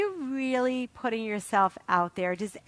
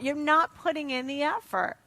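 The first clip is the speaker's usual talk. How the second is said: ordinary delivery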